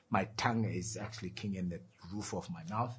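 normal talking voice

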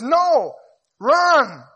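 high, loud urgent shout; panicked yell. Acted. The amygdala ordering you to run, a high loud yell. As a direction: scream the order to flee. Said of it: "Amygdala goes".

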